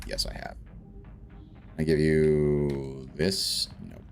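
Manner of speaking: ordinary delivery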